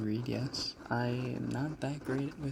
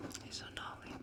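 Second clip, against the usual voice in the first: very soft whispered request; 14 dB quieter than usual. Shown as a demonstration. Streamer saying a requested name for a viewer, whispered. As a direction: whisper it right into the mic.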